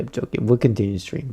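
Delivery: usual speaking voice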